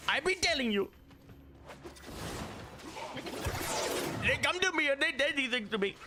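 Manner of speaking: high, hammed-up Indian-accented Trump voice; playful Indian-accent Trump impression. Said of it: "Your Indian Trump is one".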